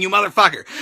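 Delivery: ordinary talk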